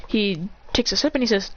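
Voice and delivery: normal talking voice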